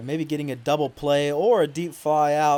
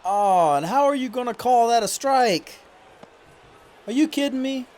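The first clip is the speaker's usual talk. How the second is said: outraged disbelief; 10 semitones higher, 5 dB louder than usual. Real, not acted. Player yelling at the umpire over a strike, outraged. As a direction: voice jumps much higher and louder in protest.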